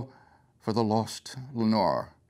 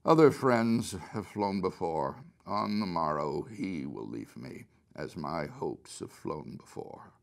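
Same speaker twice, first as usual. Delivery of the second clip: muttered, sinking softer and lower to the end; barely more than a mutter, fading away; 7 semitones lower, 6 dB quieter than usual. A narrator's reading. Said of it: "scarcely more than muttered".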